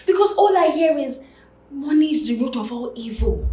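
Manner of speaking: high, loud complaint; loud protest. Acted. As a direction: quote the saying loudly in high-pitched protest. Protests against a saying.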